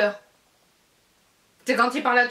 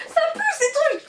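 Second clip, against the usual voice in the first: laughing disgust; faster than usual. Real, not acted. Bad taste lingering.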